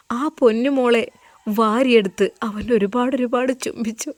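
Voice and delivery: usual speaking voice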